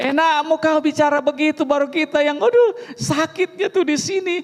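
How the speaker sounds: high, hammed-up hurt whine; mock complaint. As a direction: overplay the hurt for laughs.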